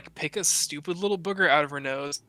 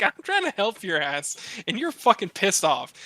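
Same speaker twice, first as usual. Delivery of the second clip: higher, exasperated retort; mock indignant; 6 semitones higher, 3 dB louder than usual. Dad retelling complaining to his furious baby, exasperated. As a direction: protest like an offended helper. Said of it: "I'm like".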